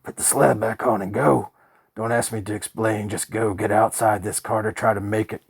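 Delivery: loud, urgent panicked orders; wild and scared. A narrator's reading. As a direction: drive him away in terror. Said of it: "he sounded wilder, scareder".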